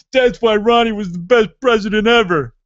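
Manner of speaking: loud, high mocking fanboy yell; rabid Reagan fan. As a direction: bellow it like a fanatical supporter. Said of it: "everybody that is a huge Reagan fan".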